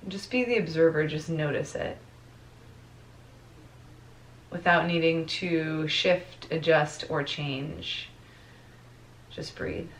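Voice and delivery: soft meditation voice with long pauses; guided meditation. Real, not acted. Teacher guides class to notice their breath, guided meditation voice. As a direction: ease them into the breath, letting phrases fade softly.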